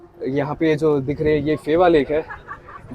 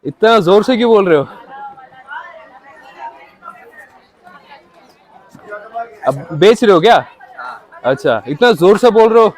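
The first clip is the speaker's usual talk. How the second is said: raised voice; 7 semitones higher, 9 dB louder than usual. Real, not acted. Calling out to loud chai seller.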